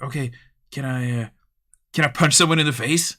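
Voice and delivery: a gleeful troublemaker testing the rules; mischievous player. Retold or imitated. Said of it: "It's like".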